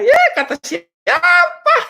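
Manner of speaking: very high, drawn-out mock shout; mocking comeback. Coach answering an online comment about money, loud mocking squeal. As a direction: squeal it loudly, half laughing, stretching the words.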